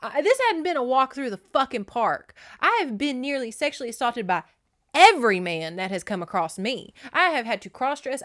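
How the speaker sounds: loud, high defiant retort; bucking up defiantly. Retold or imitated. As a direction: stand your ground, hitting every wrong done to you. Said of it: "She bucks up".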